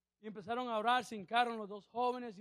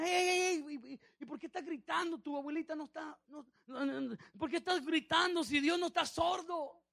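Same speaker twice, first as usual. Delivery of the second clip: lightly voiced boy, pitched higher; other child protesting; 7 semitones higher, 5 dB louder than usual. One boy to his shouting brother at prayer, lightly voiced protest. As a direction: object to the yelling in a higher voice. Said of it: "Y el otro niño viene".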